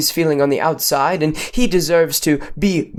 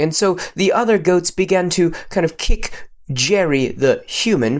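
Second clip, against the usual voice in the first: plain reading voice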